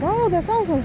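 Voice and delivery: mildly gush, retelling your own reply; retold phone reaction. Daughter reacting to dad's news on the phone, retold enthusiasm. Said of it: "I'm like".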